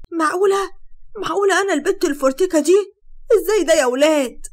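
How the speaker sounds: high, loud cry of disbelief at her own reflection; gasping it out, she can't believe it's her; stunned disbelief. A narrator's reading. Sara sees herself in the salon mirror, high astonished cry.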